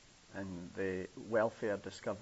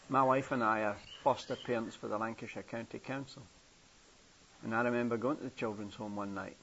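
ordinary talk, nothing marked

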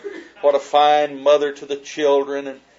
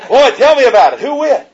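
eager mock excitement; 10 semitones higher, 11 dB louder than usual. Eager guys quizzing Jack.

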